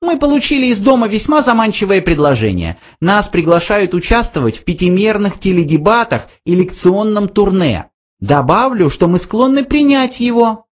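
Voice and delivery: high little mouse voice; pleased mouse. A narrator's reading. Mouse tells of a tempting offer, high voice. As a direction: announce it in a high, small voice.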